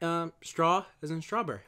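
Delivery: normal talking voice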